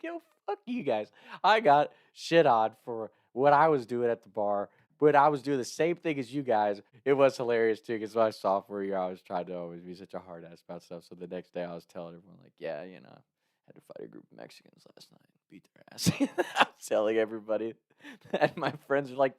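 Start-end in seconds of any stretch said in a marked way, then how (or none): 0.0-1.1 high, playful comeback to friends; mock retort. He snaps back at his laughing buddies, high and mock-indignant. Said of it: "I'm like". 12.6-16.1 low, hushed, half-whispered boast; mock tough brag. He brags to friends about a fight he never won, hushed and low. Bragging falsely to everyone next day.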